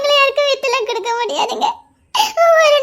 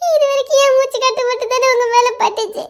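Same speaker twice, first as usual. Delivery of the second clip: normal talking voice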